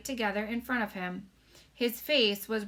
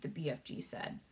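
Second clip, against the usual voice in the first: plain reading voice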